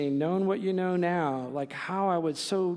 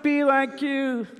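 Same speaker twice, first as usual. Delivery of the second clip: put-on high, slow, louder little-boy voice; 6 semitones higher, 6 dB louder than usual. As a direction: play the eager four-year-old. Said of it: "And the boy says".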